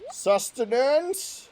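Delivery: high, slow rising call; drawn-out question. Pretend. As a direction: stretch the word out, pleading.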